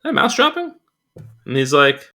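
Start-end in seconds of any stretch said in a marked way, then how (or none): 0.0-0.7 squeaky, much higher alarmed question; startled high-pitched worry. Retold or imitated. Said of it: "I was like".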